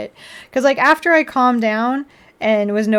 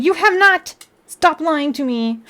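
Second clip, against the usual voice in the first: sharp, high indignant outburst; indignant retort; 6 semitones higher than usual. Retold or imitated. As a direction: call out the lie, fed up. Angry retort to delivery card.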